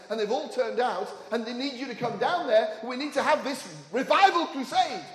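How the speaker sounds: rousing revival preacher; impassioned preaching. Acted. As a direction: preach it forcefully, building to a peak. Preaches at full force.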